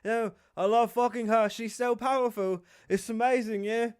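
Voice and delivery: put-on prince voice, eager and high; Harry impression. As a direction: imitate a smitten prince overselling it. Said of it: "He said".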